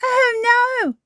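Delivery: put-on high, loud toddler alarm; dismayed cry. A narrator's reading. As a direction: gasp out in alarm. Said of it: "George cries".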